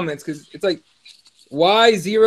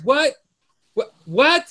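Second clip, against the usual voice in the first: higher, rising what; incredulous what; 5 semitones higher, slower than usual. Real, not acted. Host scoffs at claims Nate fought bigger guys, incredulous. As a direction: toss out each what in disbelief. Disbelief at Nate being called small.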